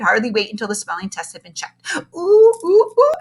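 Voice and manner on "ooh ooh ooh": high, loud eager squeals; excited ooh. Acted. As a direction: squeal with glee. Excited waiting for her score.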